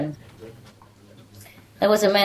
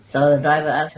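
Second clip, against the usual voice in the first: ordinary talk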